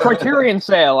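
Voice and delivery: excited sale announcement. Retold or imitated. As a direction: hype it up like a big sale. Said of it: "You guys are like".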